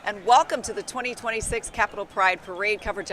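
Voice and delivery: loud upbeat broadcast opener; parade commentator. Acted. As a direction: announce it big over the music. Opens the live broadcast.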